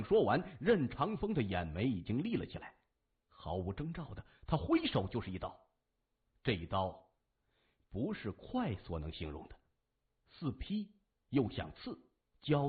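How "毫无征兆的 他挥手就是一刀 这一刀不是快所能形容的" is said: ordinary delivery, nothing marked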